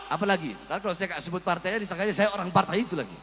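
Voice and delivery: low, very soft, quick mutter; offhand aside. Real, not acted. Preacher explaining why he names every party, offhand aside. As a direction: drop right out of oratory into a hurried aside.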